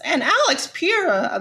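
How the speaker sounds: loosely imitating the podcaster's mispronunciation; Chael Sonnen impression. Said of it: "that's not a good Chael Sonnen impression".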